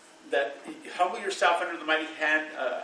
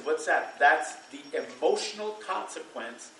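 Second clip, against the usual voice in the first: ordinary delivery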